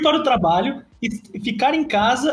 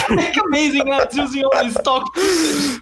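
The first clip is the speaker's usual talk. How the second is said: mock ad read; 3 semitones higher, slower than usual. Parodying a recorded ad read.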